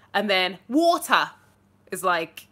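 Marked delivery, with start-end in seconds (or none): 0.7-1.3 loud, slow, grimy London "water"; grimy lower-class English accent. Shown as a demonstration. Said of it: "a bit more grimy".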